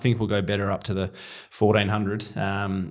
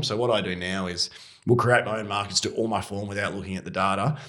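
ordinary talk

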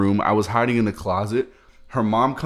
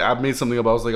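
usual speaking voice

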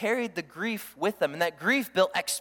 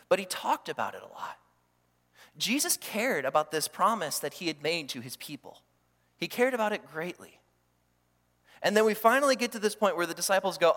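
ordinary delivery

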